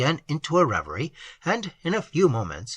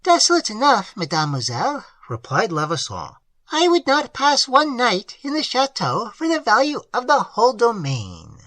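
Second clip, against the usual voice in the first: put-on higher, earnest voice for the old host; higher voice of a plain-spoken country host; old peasant host; 9 semitones higher, 3 dB louder than usual. A narrator's reading. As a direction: voice the simple host, eager to tell. Host refuses the chateau.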